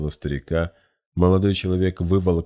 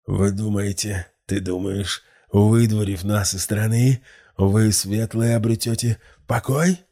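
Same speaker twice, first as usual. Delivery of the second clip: higher, slow sneering hiss; hissing old man; 5 semitones higher, 4 dB louder than usual. A narrator's reading. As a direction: hiss it back at the young man. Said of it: "прошипел старик".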